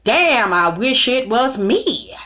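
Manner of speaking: loud, drawn-out mock envy; cheering it on. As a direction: swoon slowly and loudly, imitating a jealous admirer. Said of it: "like, cheering it on".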